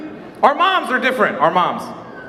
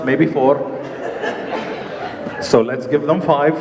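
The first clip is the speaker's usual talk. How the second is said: put-on Arabic accent; 6 semitones lower than usual.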